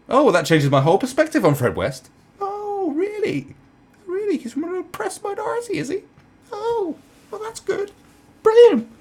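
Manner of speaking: high, loud mock astonishment; put-on squeal of being won over; sarcastic amazement. Comedian replying to a feeble excuse, sarcastically amazed.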